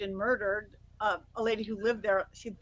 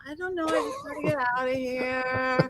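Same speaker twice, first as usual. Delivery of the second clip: little trance voice. Retold or imitated. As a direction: stretch it out in a little entranced voice.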